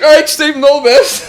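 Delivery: high, loud sarcastic send-off; teasing a mate walking away; mock farewell. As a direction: chirp it like a cheeky goodbye. Mocking friend backing off.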